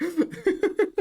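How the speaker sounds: mock-offended through laughter; laughing. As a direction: giggle through the words.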